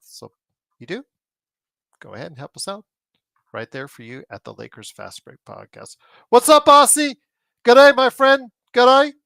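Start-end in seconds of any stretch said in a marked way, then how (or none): 6.3-9.1 loud, high-pitched mock Australian greeting; terrible Australian accent. Podcast host greeting an Aussie viewer, terrible Australian accent. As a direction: holler it in a badly faked Australian accent. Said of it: "That's my terrible Australian accent".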